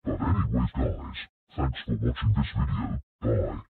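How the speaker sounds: sign-off in an artificially deep voice; low-pitched voice. Pretend. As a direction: pitch dropped right down by an effect. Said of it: "stop low pitching his voice".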